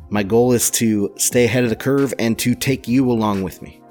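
upbeat announcer-style intro; energetic show opener over music; podcast intro. Acted.

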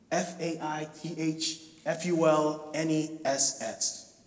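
each letter of "F A I T H F U L N E S S" said slowly and softly; spelled out. Shown as a demonstration. Preacher spelling the key word for the congregation, soft and slow. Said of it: "spells the word out".

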